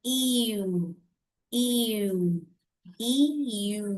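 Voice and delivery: ईयू gliding from ई to यू, repeated; teacher modelling the ईयू glide; diphthong demo. Shown as a demonstration. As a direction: show the glide on ईयू slowly. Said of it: "glide हो रहा है".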